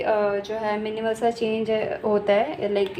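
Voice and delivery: ordinary delivery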